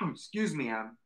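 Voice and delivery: strained, recovering from a cough; after choking. Real, not acted. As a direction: clear the throat after choking. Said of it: "water went down the wrong tube".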